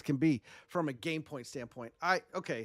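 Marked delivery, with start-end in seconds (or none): none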